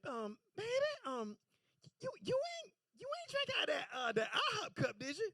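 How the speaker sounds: high, hushed worried mom voice; a mother gently prying, high-pitched and hushed. Retold or imitated.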